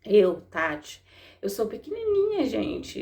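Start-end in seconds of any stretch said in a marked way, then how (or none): 1.4-2.4 high-pitched lift on "pequenininha"; small, humble. Real, not acted. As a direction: make yourself tiny with a much higher voice. Belittles herself as tiny.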